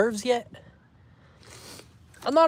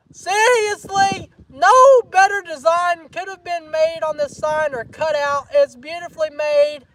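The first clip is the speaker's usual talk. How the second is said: high, loud gushing show-off; over-the-top excitement; 8 semitones higher, 6 dB louder than usual. Pretend. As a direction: hype it up like a big reveal. Hyping up a gifted sign.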